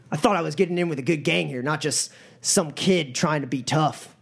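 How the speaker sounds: scornful troll heckler. Acted. Troll in the crowd mocking the small gang leader, scornful. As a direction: jeer at the boss, daring him.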